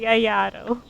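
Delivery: ordinary delivery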